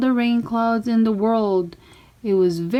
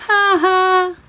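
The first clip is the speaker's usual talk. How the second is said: laughing; 10 semitones higher, 5 dB louder than usual. Acted. Friends laugh at Mac.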